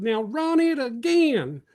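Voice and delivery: high, slow shouted order; coach yelling. Retold or imitated. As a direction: call it out across the field like the coach. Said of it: "the next words out of his mouth was".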